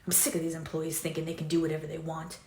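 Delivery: muttered under breath. Acted.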